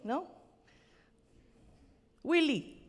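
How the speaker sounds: usual speaking voice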